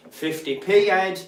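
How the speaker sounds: put-on sing-song the kid's insult and crack up; mock playground taunt. Retelling a childhood football taunt, mocking chant.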